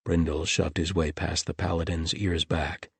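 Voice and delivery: plain reading voice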